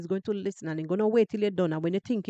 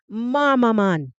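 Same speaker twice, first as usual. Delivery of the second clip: "Mama man" louder and slow in a Jamaican accent, "mama" stressed; 6 dB louder, slower than usual. Shown as a demonstration. Vlogger going half Jamaican for viewers, in a Jamaican accent. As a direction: punch it out in Jamaican. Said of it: "I am going to be half Jamaican today".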